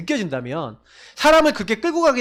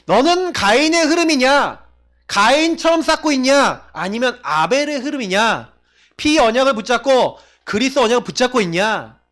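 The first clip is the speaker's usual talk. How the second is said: preaching at full force; 3 semitones higher than usual. Real, not acted. Preaching challenge to congregation.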